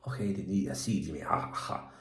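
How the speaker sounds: low, soft mock-Scottish mutter; Scottish accent. Shown as a demonstration. Showing off his attempt, low Scottish accent. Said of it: "I'm trying to do a Scottish accent".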